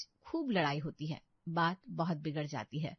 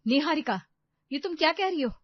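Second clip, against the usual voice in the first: high, loud, offended protest; shocked indignation; 8 semitones higher, 10 dB louder than usual. Acted. Sister-in-law reacts to Niharika's accusation, shocked indignation. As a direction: cry out her name, affronted.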